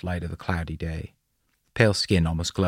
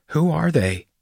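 put-on high, panicky child's question; near panic; 10 semitones higher, 4 dB louder than usual. A narrator's reading. Girl asks father about the dark figures, near panic. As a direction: pitch it up like a child close to panic. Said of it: "Dora was on the verge of panic".

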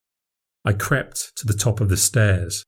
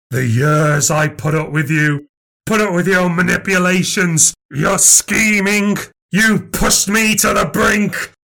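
rising rage; 7 semitones higher than usual. Acted. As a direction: let the grudge explode. Long-held grudge erupting.

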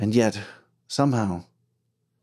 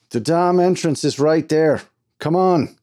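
higher, raised-voice irritation; impatient foreman; 7 semitones higher, 4 dB louder than usual. Acted. Foreman urging Archie toward the entrance, impatient. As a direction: point it out impatiently, voice up.

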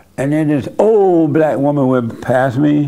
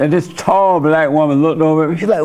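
ordinary delivery

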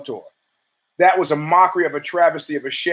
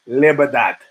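Sicilian cousin; 4 semitones lower, 3 dB louder than usual. Retold or imitated. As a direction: say Libertad like a Sicilian shrugging. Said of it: "and he goes".

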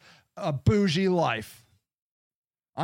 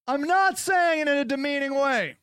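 defensive shout; 6 semitones higher than usual. Real, not acted. Defensive outburst.

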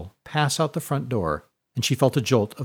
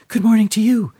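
put-on much higher, louder small girl's voice; high breathy girl; 10 semitones higher, 6 dB louder than usual. A narrator's reading. Small girl greeting Fiona, high and eager. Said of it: "in a high, breathy voice".

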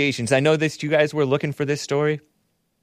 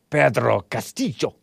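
put-on Spanish accent. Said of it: "I get hung up on pronunciations".

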